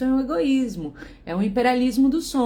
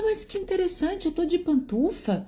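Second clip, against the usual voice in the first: higher, sing-song mock of the neighbor; mock surprised neighbor; 8 semitones higher than usual. Retelling the neighbor's reply on the intercom, mock surprised and higher. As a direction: play the neighbor as falsely amazed, pitched up.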